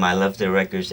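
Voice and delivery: ordinary talk, nothing marked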